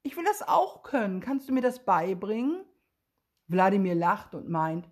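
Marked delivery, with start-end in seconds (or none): 0.0-2.6 high, eager excited call. A narrator's reading. Willi begging Wladimir to teach him flying, excited. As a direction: beg to learn it, thrilled. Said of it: "Und Willi ruft begeistert".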